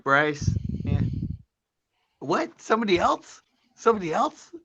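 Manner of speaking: high, loud playful dog voice; voicing the dog. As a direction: speak for the dog, squeaky and eager. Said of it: "She's like".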